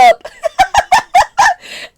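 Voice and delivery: loud high-pitched cackle; burst of high laughter. Real, not acted. She laughs at herself over the ketchup, a high cackle. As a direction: cackle at her own dumb story. Laughs at her own story.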